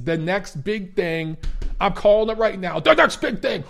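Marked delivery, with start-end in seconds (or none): 2.8-3.6 high, loud impression of a hyped boxing analyst; hammed-up fight-commentator call; Teddy Atlas impression. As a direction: proclaim it like an excited ringside pundit. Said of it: "Oh, Teddy Atlas".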